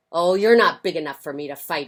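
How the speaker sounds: loud dismissive brush-off; grouchy ladybug bluster. Acted. Grouchy ladybug backing off from a fight, loud and huffy. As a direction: back down while acting tough.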